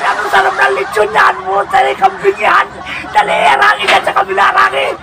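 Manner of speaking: put-on high shouting drunkards; excited drunk shouting. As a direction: yell while chasing the goat. Drunks excited chasing Satan.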